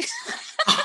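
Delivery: a sudden squealing laugh, high up; high burst of laughter. Real, not acted. Laughing at her son's dinosaur noise, high squealing laugh.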